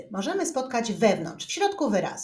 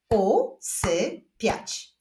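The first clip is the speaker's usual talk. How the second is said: very slow, separated syllables; word split into beats with pauses; much slower than usual. Shown as a demonstration. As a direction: clap it out one syllable at a time. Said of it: "Wyklaszczmy sylaby w tych wyrazach".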